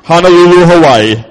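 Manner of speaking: deep, booming pompous prayer voice; hammed-up solemn invocation, low and loud; mock fancy praying. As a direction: intone it like an over-serious adult praying. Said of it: "we think we gotta be all fancy".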